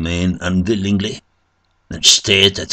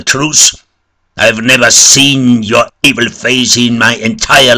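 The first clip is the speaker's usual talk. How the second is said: loud, high accusing shout; priest's forceful outraged yell; angry shout; 6 semitones higher, 9 dB louder than usual. A narrator's reading. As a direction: shout the threat at a liar. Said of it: "But the father shouted".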